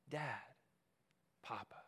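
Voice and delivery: soft, low and slow, almost a breath; tender whisper. Acted. Preacher voicing Jesus calling God Papa, tenderly. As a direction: speak it like a child to a father.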